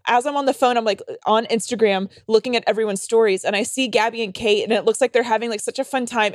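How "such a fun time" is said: ordinary delivery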